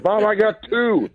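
high, drawn-out mock child voice; stretched, piping kid impression; imitating tattling daughter. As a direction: call it out slowly like a little tattletale. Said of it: "My daughter will tell me".

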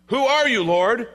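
high, loud startled cry of Saul. Acted. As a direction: cry up to the voice from heaven. Saul voiced again.

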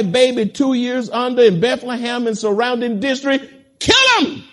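preacher working up to a shouted climax; building fury. Acted. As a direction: climb in pitch till the last words burst out.

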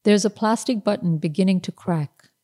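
ordinary delivery, nothing marked

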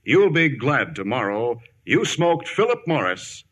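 ordinary talk, nothing marked

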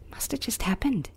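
hushed retold whisper; soft whispered reply; sleepy husband's whisper. Retold husband murmuring at night.

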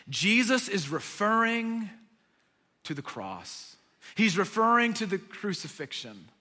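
ordinary delivery

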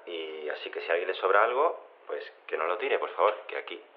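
ordinary delivery

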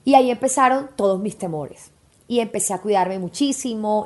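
ordinary talk, nothing marked

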